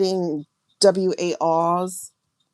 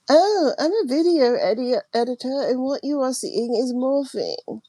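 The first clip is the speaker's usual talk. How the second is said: higher, mock know-it-all video editor; mocking the doubters; 6 semitones higher than usual. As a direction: parody a smug skeptic brushing her off. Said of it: "Instead they go".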